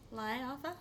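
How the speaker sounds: Life with a broad Boston vowel; Boston accent. Pretend. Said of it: "That's my Boston accent".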